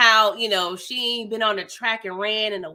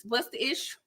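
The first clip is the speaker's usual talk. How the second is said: normal talking voice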